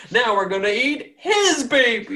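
high-pitched laughing mock cheer; hammed-up bloodthirsty villager, cracking up; gleeful villagers. Villagers turn on the fallen giant, high gleeful laughing cry. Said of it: "the villagers are just like".

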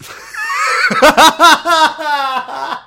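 loud, very high-pitched cackle; long shrieking laugh; high laughing fit. Real, not acted. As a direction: burst out in helpless high laughter. Cracks up reading the question.

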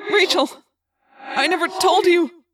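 loud, high, slow and heartfelt; anguished confession. A narrator's reading. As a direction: blurt it out before losing her. Anguished last words to friend.

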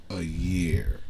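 breathy whisper, deadpan. Acted. Husband whispering his deadpan answer about his wife. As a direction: drop to a sly whisper for the punchline.

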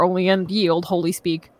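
usual speaking voice

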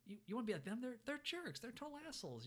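imitated high-voiced disbelief; incredulous dismissal. Acted friend dismissing jerks to comfort.

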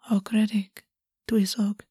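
soft murmur, half whispered. A narrator's reading. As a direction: murmur the Welsh words softly. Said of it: "August murmured".

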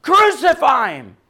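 loud, high chant of an angry mob; crowd yelling. Retold or imitated. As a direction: shout it like the crowd demanding death. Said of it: "who were yelling".

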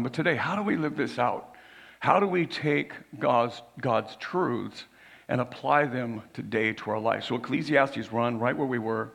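ordinary delivery

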